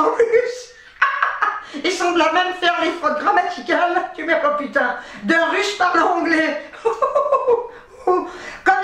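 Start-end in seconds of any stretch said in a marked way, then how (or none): 1.0-1.8 high, airy cackle; real helpless laugh; burst of laughter. Real, not acted. Cracks up at Russian accent. 6.8-7.7 high-pitched giggling peal; real uncontrolled laugh; burst of laughter. Real, not acted. Cracks up at the anecdote.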